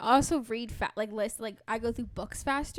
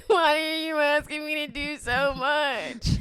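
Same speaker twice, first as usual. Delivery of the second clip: slow, higher mock wail; retold overwhelmed whine; 4 semitones higher, 3 dB louder, slower than usual. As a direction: drag it out in hammed-up exasperation. Retold meltdown at partner's request.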